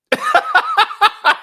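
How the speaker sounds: high shrieking burst of laughter; loud laugh. Real, not acted. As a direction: crack up loudly, very high. Laughing at season two joke.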